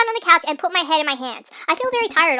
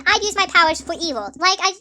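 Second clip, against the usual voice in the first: ordinary talk, nothing marked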